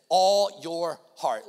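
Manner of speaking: "All" drawn out slowly and very loud; slow, loud emphasis on "All"; stress on all. Shown as a demonstration. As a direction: lean long and hard on "All". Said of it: "love isn't even the most repeated word".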